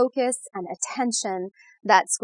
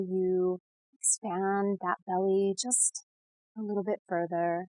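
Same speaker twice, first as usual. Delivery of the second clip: guided meditation; 4 dB quieter than usual. Real, not acted. Guide leading listeners to settle and breathe, soothing meditation voice. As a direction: ease the listener gently into calm, unhurried.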